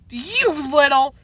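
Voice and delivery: drawn-out acted anger; exasperated. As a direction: stretch it out at a naughty brother. Narrator furious at brother's prank.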